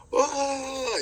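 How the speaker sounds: high-pitched mock "whoa" of astonishment; imitated shock. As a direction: mimic a stunned onlooker, voice shooting up. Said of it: "all shocked".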